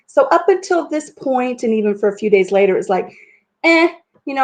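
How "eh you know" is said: higher, louder dismissive "eh". Retold or imitated. Storyteller downplaying her arm injury, mock-dismissive. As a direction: brush it off with a quick eh. Acted shrug at a minor injury.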